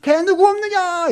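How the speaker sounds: very high, loud, slow bellow for help; shout. Acted. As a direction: yell out to summon everyone. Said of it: "소리를 지르는 거예요".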